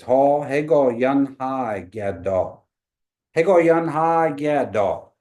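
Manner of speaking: "Hall, Hey, Gaul, Yan Hai Gadol" slowly, loud, last part drawn out; careful teacher model; slow Kiowa phrase. Shown as a demonstration. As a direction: spell out the Kiowa line for the class. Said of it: "But it says".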